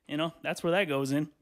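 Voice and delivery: normal talking voice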